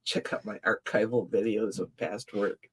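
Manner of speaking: slow, low announcer read; low radio voice. Shown as a demonstration. Co-host trying out a radio sounder, slow low announcer voice. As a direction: intone it like a radio promo. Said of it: "I can do it 'cause I got a low voice".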